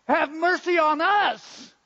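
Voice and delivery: loud, high, drawn-out cry for mercy; calling desperately from far off; desperate shouting. Acted. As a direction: shout it out, pleading to be noticed. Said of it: "jumping and waving and shouting".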